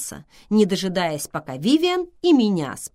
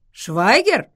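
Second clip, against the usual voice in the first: louder, higher bewildered question; puzzled; 5 semitones higher, 8 dB louder than usual. A narrator's reading. Thomas hears an unexpected name, asks bewildered. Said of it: "недоумённо спросил Томас".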